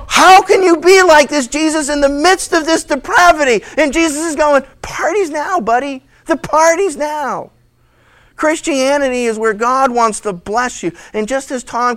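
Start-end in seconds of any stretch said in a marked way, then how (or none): 0.1-3.6 high, loud, hammed-up indignation; scandalized. Disciples questioning Jesus among sinners, mock scandalized. 4.8-7.5 high-pitched, carefree reply; Jesus. Acted. Jesus answering his appalled disciples, breezy.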